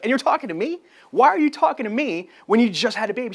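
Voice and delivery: high, loud amazed question; can't believe she's on the phone; amazed disbelief. Retold or imitated. Salesman amazed a new mother is calling him.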